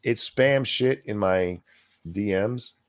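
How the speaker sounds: normal talking voice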